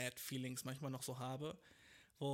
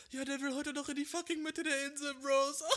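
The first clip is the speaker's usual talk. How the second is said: much higher, loud sneering call; mocking clerk; 11 semitones higher, 6 dB louder than usual. Clerk mocking the traveller to colleagues, loud sneer. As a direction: announce his folly to the whole office. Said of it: "die lachen alle so fucking in mein Face".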